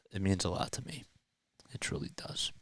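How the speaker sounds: sincere whisper to viewers. Real, not acted. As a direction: breathe it softly, meaning it.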